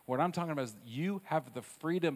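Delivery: normal talking voice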